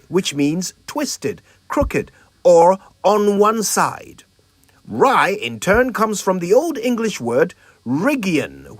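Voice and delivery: measured lesson read with lifted pitch; scripted word lesson. Acted. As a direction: present it like a polished language feature. Presents a scripted etymology lesson.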